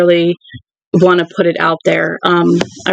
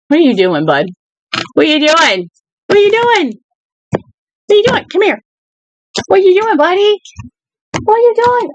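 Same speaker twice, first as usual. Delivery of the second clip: much higher cooing pet voice, over and over; 10 semitones higher than usual. Real, not acted. Cooing at her cat.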